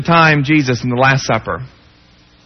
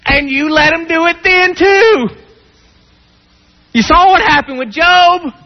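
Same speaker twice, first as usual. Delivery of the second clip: impassioned preaching; 12 semitones higher than usual. Acted. Preacher challenging God over Peter, impassioned and pleading. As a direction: press the questions like an aggrieved friend. Preacher voices indignant protest.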